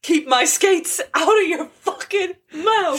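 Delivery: laughing [name] parody. Retold or imitated. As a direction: mangle the quote while cracking up. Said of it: "[name]'s gonna come in".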